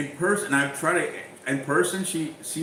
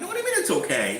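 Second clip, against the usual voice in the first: much higher, indignant retold protest; outraged disbelief; much faster than usual. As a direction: push back hard, voice leaping up. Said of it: "And I'm like".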